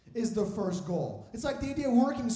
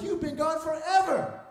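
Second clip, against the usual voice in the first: high, loud retold complaint; hammed-up scolding, raised voice; mock exasperation; 5 semitones higher, 5 dB louder than usual. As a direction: call it out like a fed-up coworker. Acts crew scolding his return.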